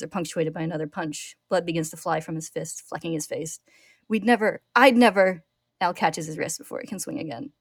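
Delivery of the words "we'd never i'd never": louder, rising anguished shout; breaking fury; desperate denial. A narrator's reading. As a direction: hammer each never. Ed punching Tucker, desperate.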